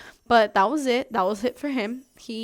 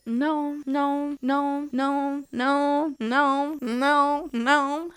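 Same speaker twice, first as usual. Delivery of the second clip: repeated no; 4 semitones higher, much slower than usual. Retold or imitated. Refusing the jet ski repeatedly.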